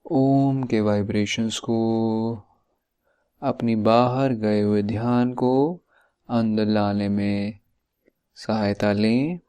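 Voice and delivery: measured instructions with drawn-out endings and long pauses; guided meditation. Acted. Coach guiding listeners to tighten and relax muscles, guided meditation voice. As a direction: lead the body relaxation step by step, stretching the last syllables.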